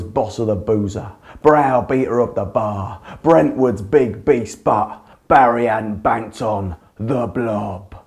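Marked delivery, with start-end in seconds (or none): none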